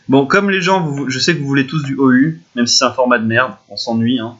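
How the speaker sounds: ordinary talk, nothing marked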